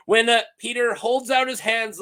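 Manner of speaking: normal talking voice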